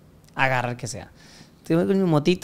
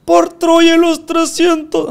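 much higher, louder, slow epic shout; rally the troops, hammed-up; director's hammed-up war cry; mock battle cry; 11 semitones higher, 7 dB louder, much slower than usual.

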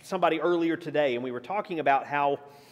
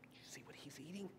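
gossipy whisper; 23 dB quieter than usual. Retold or imitated. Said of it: "people be looking going".

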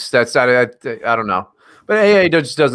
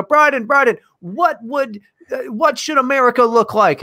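mock paparazzi; 10 semitones higher than usual. Paparazzi voiced.

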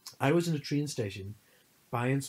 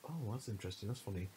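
quiet musing; 10 dB quieter than usual. Retold or imitated. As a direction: mutter it as if mildly intrigued. Retold reaction to overheard gossip.

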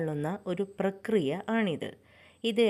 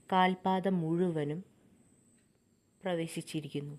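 calm, softer meditation voice with long pauses; guided meditation; 2 semitones lower, 3 dB quieter than usual. Acted. Meditation guide leading listeners through breathing and rising energy, calm and measured. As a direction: guide them gently through each breath and body part, unhurried.

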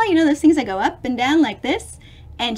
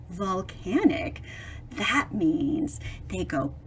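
hushed buildup; 6 semitones lower, 9 dB quieter, slower than usual. Acted. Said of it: "building suspense before boom".

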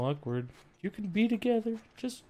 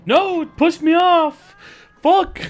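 higher, louder, slowed cry; frustrated yell; 9 semitones higher, 9 dB louder, slower than usual. Real, not acted. Streamer pushed off a ledge, frustrated yell. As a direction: yell out as you fall.

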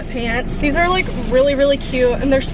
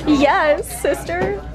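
sassy cheer; 4 semitones higher, much slower than usual. Real, not acted. Friend cheers about bargains.

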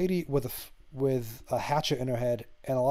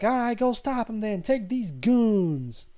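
high Southern voice, stretching the last words; put-on Southern drawl, pitched up; overdone Southern accent; 8 semitones higher, 4 dB louder, slower than usual. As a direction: imitate a Southern woman sending someone off, slow at the end. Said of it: "putting that Southern accent on hard".

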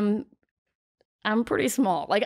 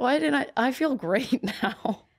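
higher-pitched mock revelation; sarcastic amazement; 5 semitones higher than usual. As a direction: pretend to be miraculously cured, laughing through it. Pretending the advice cured her.

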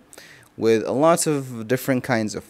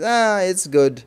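lukewarm, higher and slow; unimpressed; 7 semitones higher, slower than usual. Retold or imitated. Guest sounding lukewarm about the food. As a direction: shrug it off as just okay. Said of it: "this means".

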